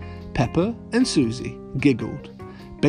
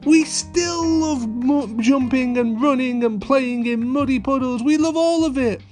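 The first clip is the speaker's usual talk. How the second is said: put-on high, eager children cheering; excited little girls; 11 semitones higher than usual. A narrator's reading. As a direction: cheer together like happy kids. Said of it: "cried Susie and Peppa".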